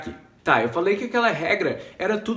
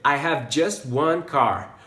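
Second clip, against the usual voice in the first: slow clear demo; 3 semitones lower, much slower than usual. Shown as a demonstration. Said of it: "eu poderia dizer".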